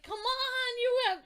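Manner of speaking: high, loud pleading yell; frantic fan shout; urgent cheering. Retold or imitated. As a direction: egg the hero on loudly. Reenacts urging the hero at screen.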